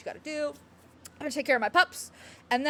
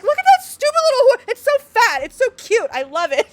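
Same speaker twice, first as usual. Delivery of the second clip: much higher, louder cutesy gushing; gushing over horse; 11 semitones higher, 11 dB louder than usual. Retold or imitated. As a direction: coo over the fat little horse, high and loud. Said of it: "I'd be like".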